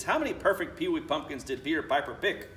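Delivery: plain reading voice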